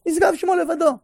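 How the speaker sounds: high-pitched, impassioned declaration; exalted, pitched far above normal talk; fervent answer. Real, not acted. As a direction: deliver the answer with rising fervour. Fervent climax answering own question.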